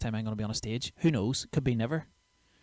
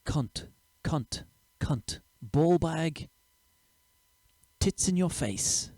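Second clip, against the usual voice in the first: test words for subtitles; 6 semitones higher, much slower than usual. Shown as a demonstration. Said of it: "will we say".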